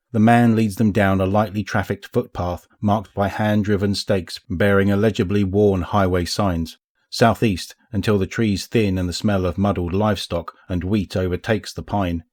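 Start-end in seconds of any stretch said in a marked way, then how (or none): none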